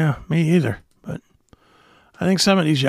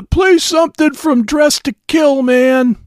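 annoyed fan voice; 8 semitones higher, 5 dB louder, slower than usual. Retold or imitated. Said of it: "They want me to go and say".

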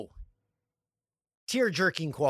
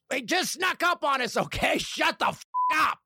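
high-pitched, hammed-up exasperation; mock flustered excuse bursting into a yell; defensive outburst; 10 semitones higher than usual. Company caught out by the anniversary, mock defensive outburst.

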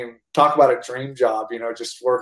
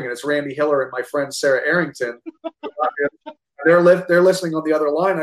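normal talking voice

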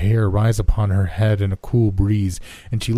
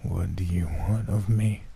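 hollow words; 6 dB quieter than usual. A narrator's reading. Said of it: "each word struck hollow upon the last".

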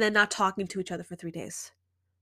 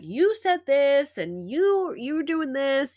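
higher, louder, slow accusing jabs; mock accusation; 5 semitones higher, 4 dB louder, slower than usual. Shown as a demonstration. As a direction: point the finger with each word. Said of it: "when you go accuse him".

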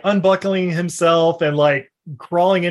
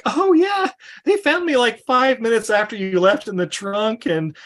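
put-on high, airy girly voice, chattering on; Nina's voice; 6 semitones higher than usual. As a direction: play her as a breezy actress brushing it off. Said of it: "she's like".

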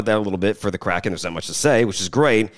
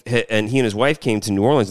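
ordinary talk, nothing marked

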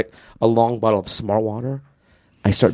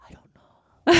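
whispered, abashed retold answer; sheepish whisper; 4 dB louder, much slower than usual. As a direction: whisper it as if caught out. His retold abashed answer to Chris.

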